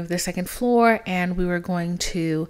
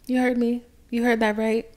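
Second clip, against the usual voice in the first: ordinary talk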